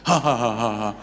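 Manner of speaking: loud staged ha ha ha; mock laugh. Preacher mimics a friend laughing at a nickname, mock laugh. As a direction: laugh at him like a bully.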